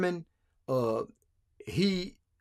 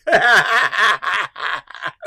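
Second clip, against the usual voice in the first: big real burst of laughter; loud belly laugh; 7 dB louder than usual. Real, not acted. Host reading a comment calling him bigot, loud amused laughter. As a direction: crack up loudly at the insult. Reacts to being called a bigot.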